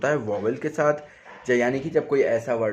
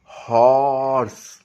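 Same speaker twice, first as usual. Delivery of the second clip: slow motion; 6 dB louder, much slower than usual. Shown as a demonstration. Teacher slowing horse for students. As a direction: stretch horse out very slowly and a bit louder. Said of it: "अगर मैं slow motion में बोलूँ".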